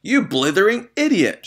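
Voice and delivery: high, loud raging yell; furious shout. A narrator's reading. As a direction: scream at the boy. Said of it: "shouted the Trunchbull".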